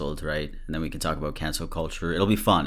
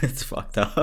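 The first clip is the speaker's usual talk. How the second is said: laughing through the words; can't stop laughing at it; 8 semitones higher than usual. Real, not acted. Vlogger laughing while calling the clip messed up. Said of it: "I know I'm laughing".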